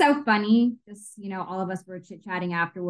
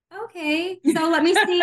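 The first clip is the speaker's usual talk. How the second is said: much higher, half-laughing, intrigued; high giggly curiosity; curious surprise; 8 semitones higher, 4 dB louder than usual. Retold or imitated. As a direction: lean in, amused and puzzled. Retelling her curious reaction.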